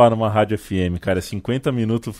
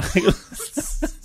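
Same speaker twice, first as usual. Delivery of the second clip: airy laugh; 13 semitones higher than usual. Real, not acted. Laugh after imitation.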